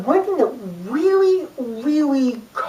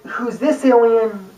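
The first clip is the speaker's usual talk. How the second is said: slower, patient quiz voice; slow, drawn-out question; slower than usual. Retold or imitated. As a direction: draw out the question and wait. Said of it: "Instead of going".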